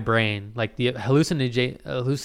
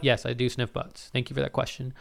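ordinary delivery

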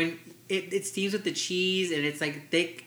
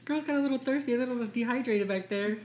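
quoting a performer; 8 semitones higher than usual. Retold or imitated. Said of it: "she was like".

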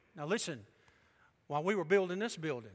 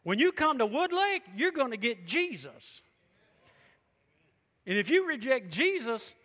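raised, ringing preacher voice; preaching; 6 semitones higher, 5 dB louder than usual. Acted. Pastor preaching hard to his congregation, loud and ringing. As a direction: preach it out with full force.

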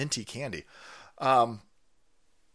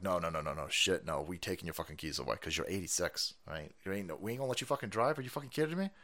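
family to grandma; 9 semitones lower, 10 dB quieter than usual. Retold or imitated. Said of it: "We're like".

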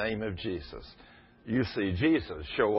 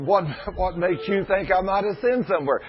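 higher, louder mock indignation; lightly played astonished husband; surprised retort; 8 semitones higher, 4 dB louder than usual. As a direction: act taken aback by the question. Husband asked if he sinned.

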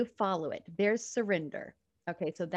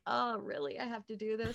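mock-dismayed protest, pitched higher; reluctant complaint; 4 semitones higher than usual. Speaker retells balking at the trip to dad's house, mildly whiny reluctance. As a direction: balk at an unwelcome chore, lightly exaggerated.

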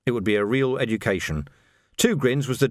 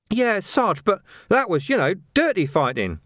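high, hesitant lad's voice; uneasy junior constable, voiced high; protesting young recruit; 9 semitones higher than usual. A narrator's reading. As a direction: object nervously, pitched well up.

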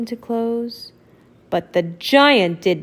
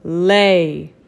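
dim giant cyclops; 4 semitones lower, 4 dB louder than usual. Acted. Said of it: "Then the giant said".

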